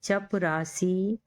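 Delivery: very slow, joining the syllables; slow blending. Shown as a demonstration. Teacher slowly blending syllables for students. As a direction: draw the word out as you blend it. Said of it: "चे पे चप".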